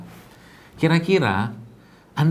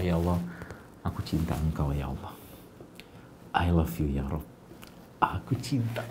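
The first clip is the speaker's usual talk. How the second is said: much softer, hushed and lower, spoken as a private whisper to God; intimate prayer; 9 semitones lower, 12 dB quieter than usual. Shown as a demonstration. The preacher models praying alone at home to Allah: softer, lower, hushed and tender. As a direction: pour out love to Allah quietly, alone on the prayer mat. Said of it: "ngomong sama Allah".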